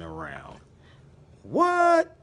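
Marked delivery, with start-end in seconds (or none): 1.4-2.2 high, loud startled what; mock shock. Reading the text and faking shock, high loud what. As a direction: act stunned at the page.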